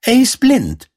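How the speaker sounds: high, loud character voice; story character voice. A narrator's reading. A character tells Däumelinchen about the blind neighbour, in a high, loud voice. Character speech inside narrated tale.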